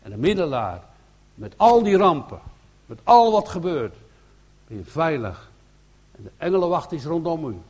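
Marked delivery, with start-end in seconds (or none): 1.3-4.0 louder and higher, pressing each phrase; full-force pulpit emphasis; preaching loud. Real, not acted. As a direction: proclaim the disasters over the crowd. Preacher stresses the disasters.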